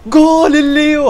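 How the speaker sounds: stretched goal cry; commentator goal call. Real, not acted. Celebrates Messi-style goal.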